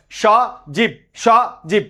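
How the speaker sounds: সজীব in two breath units, "sho... jib"; syllable by syllable. Shown as a demonstration. Teacher demonstrating breath units to students, সজীব split in two. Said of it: "এক নিঃশ্বাসে যতটুকু উচ্চারিত হয়".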